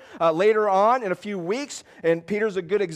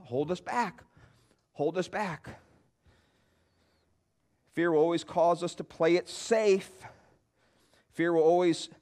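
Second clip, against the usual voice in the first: ordinary delivery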